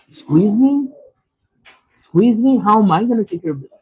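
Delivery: high, loud outraged disbelief; mock-offended customer; indignant squeeze me. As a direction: scoff in outrage at the bill. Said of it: "I'm like".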